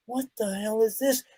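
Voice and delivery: half to yourself, stunned; hushed disbelief. Retold or imitated. Discovering the mortar.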